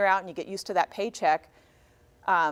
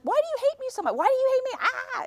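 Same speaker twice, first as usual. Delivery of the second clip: much higher whiny mock despair; mock wail; 3 dB louder than usual. Mom mock-wailing at her grumbling daughter. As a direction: ham up a wounded mother's wail.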